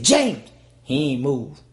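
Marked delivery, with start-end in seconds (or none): 0.0-0.5 calling his name, worried. Retold or imitated. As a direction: call the brother's name urgently. Calling to fallen brother.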